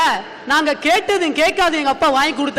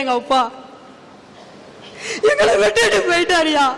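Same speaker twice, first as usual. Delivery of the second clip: breaking into tears. Real, not acted. Telling an audience her father left them, crying. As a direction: voice cracking with a real sob.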